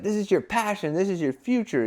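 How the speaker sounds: high, punchy motivational lines; inspirational brand tone. Retold or imitated. Brand talking to young adventurers, high motivational push. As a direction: pump the listener up. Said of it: "that's the tone we put out on everything".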